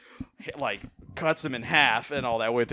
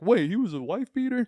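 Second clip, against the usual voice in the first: high-pitched incredulous question; shocked disbelief; 12 semitones higher, 3 dB louder than usual. Retold or imitated. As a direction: ask it like a stunned fan, pitch jumping up. Imitates shocked moviegoers' question.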